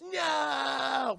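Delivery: high, loud cry of no; anguished shout. Retold or imitated. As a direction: wail it in hammed-up grief. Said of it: "And Clark goes".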